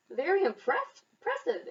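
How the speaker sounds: high, impressed exclamation; exclaiming. A narrator's reading. Mouse praises bear's headstand, high and excited. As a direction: gush with delight. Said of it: "exclaimed the mouse".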